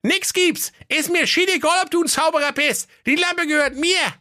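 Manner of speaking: put-on very high, defiant boy voice; defiant lad. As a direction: shout back like a stubborn kid. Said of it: "er rief".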